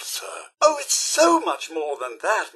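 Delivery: normal talking voice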